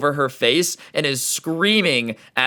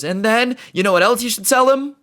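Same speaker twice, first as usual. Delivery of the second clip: high, louder taunting question; heated taunt; 10 semitones higher, 3 dB louder than usual. Retold or imitated. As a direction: pile it on, pitched way up. Clerk escalates his rant.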